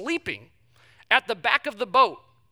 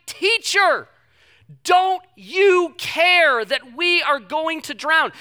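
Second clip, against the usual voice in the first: high, loud panicked cry, slowing at the end; shouting in panic; 9 semitones higher, 9 dB louder, much slower than usual. Retold or imitated. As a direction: yell at the teacher as the boat sinks.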